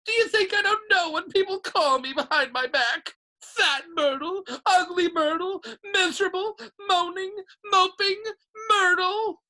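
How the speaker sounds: high, loud tearful tirade; wailing. A narrator's reading. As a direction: wail each cruel nickname in hurt. Myrtle breaks down in tears.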